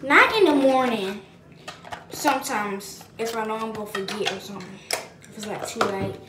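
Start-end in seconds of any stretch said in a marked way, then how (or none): none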